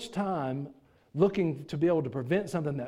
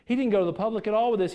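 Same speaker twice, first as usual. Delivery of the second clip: ordinary talk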